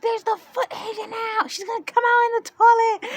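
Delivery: very high, loud panicked shriek; mock freaking out. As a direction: shriek like hysterical relatives, hammed up. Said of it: "My mom freaking out, my sister going crazy".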